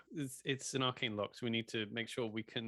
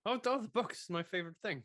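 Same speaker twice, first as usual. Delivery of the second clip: high excited delight; 9 semitones higher, 3 dB louder than usual. Acted. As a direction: gush over the books. Delighted at finding books.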